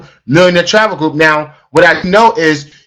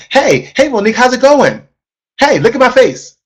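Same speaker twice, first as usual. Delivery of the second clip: high, bright-eyed greeting with a big smile; smiling phone voice; 6 semitones higher than usual. Shown as a demonstration. Coach demonstrating to the team a smiling, high phone greeting. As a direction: beam through the phone at her. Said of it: "Don't I sound different?".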